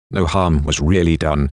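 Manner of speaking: usual speaking voice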